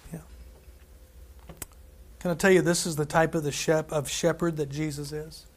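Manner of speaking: ordinary delivery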